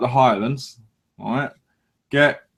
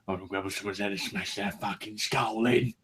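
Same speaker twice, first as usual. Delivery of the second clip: mock inner violent voice; 4 semitones lower, 10 dB quieter than usual. Knees urging him to violence.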